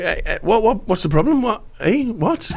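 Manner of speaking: high, rising mock-confused protest; imitating Bielsa's baffled innocence. Pundit mimicking the Leeds coach after spygate, high baffled protest. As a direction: pitch every what up, shrugging innocence. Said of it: "And Bielsa's like".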